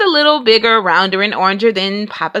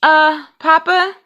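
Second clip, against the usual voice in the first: hesitant Brother; 7 semitones higher than usual. A narrator's reading. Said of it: "Brother said".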